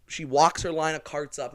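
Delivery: ordinary talk, nothing marked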